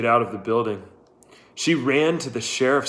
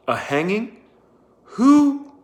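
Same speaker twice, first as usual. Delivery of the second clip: stunned disbelief, rising to a cry; shocked; 10 semitones higher, 5 dB louder, slower than usual. A narrator's reading. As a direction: pitch leaps high and loud on who. Shock at hanging news.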